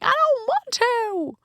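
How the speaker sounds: put-on high, slow childish wail of refusal; temper tantrum. Fists flying in the closet, wailing like a child. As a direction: stretch it out like a kid refusing. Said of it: "throwing my fists in the closet".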